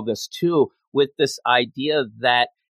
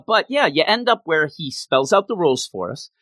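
normal talking voice

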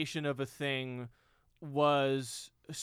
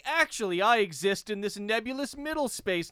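mock-pretentious, raised pitch; 6 semitones higher, 3 dB louder than usual. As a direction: put on a self-important know-it-all. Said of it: "that's pretentious".

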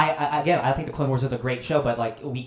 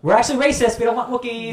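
mock villain voice; 8 semitones higher than usual. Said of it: "they're like".

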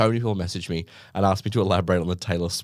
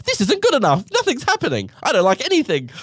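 higher, louder fussy mum; complaining mum; 13 semitones higher, 4 dB louder than usual. Retold or imitated. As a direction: whine like a picky mum sending food back. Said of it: "my mum's like".

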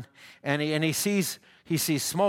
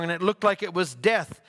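usual speaking voice

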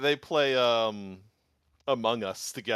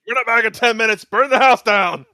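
shouting; 10 semitones higher, 14 dB louder than usual. Acted. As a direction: yell the command at them. Quoting Joseph's line.

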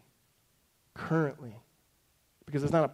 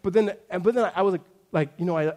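ordinary delivery

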